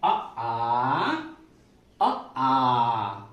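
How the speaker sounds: drawn-out long आ after short अ; teacher sounding out अ then a stretched आ; vowel demo, long aa. Shown as a demonstration. Teacher to pupils, modelling vowels, long आ stretched. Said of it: "तो पढ़ने का क्या तरीका है?".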